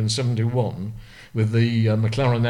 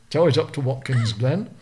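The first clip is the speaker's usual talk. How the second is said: ordinary talk, nothing marked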